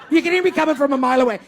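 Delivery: usual speaking voice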